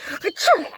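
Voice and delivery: loud, high acted sneeze. Rabbit sneezing in the shed, loud and high. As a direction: burst out with a sneeze. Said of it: "Presently Peter sneezed".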